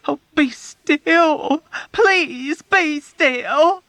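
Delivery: high, very slow pleading through tears; sobbing plea. A narrator's reading. Nurse Kramer sobbing at the patients to be still. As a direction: beg them through sobs. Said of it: "urged uselessly through her massive sobs".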